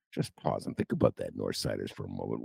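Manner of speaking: mock hushed. [name] telling North Siders to ponder, hushed mock solemnity. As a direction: drop to a hush as if in awe.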